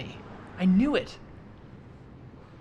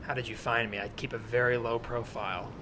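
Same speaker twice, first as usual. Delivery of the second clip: low, quiet, suspicious man; lightly voiced guarded loner; wary stranger; 5 semitones lower, 5 dB quieter than usual.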